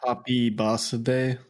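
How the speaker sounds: slow Japanese katakana-style English; katakana pronunciation. Shown as a demonstration. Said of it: "would be how it's said in katakana".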